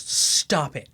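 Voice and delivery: slow, emphatic scolding, each word leaned on; fed-up mom. Retold or imitated. Mom in the back seat scolding her son, imitated exasperation. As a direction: imitate an exasperated mother laying down the law. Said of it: "and she just goes".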